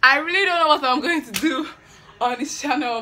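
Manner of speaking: high, loud hyper burst; excited. Real, not acted. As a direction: let the excitement spill over. Hyper rambling.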